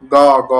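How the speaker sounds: "গ" with open aw vowel, gaw; as written. Shown as a demonstration. Teacher isolates the written letter, loud "গ" with open aw. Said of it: "লেখা হয় কিভাবে".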